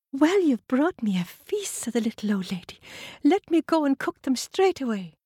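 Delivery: put-on high, welcoming old lady; sweet old lady. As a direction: invite them in kindly.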